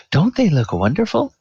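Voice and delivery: high, sugary voice raving over her nails; gushing half-elf lady. Acted. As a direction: coo at the manicurist like she's a miracle worker.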